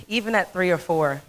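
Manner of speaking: ordinary talk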